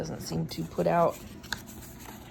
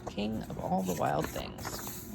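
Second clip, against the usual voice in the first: ordinary delivery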